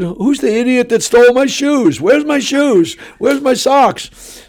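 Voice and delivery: high, bewildered demand; indignant confused outburst. Retold or imitated. A preacher waking from a faint, demanding his shoes and socks, high and bewildered. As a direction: sound like you just woke up and your shoes are gone.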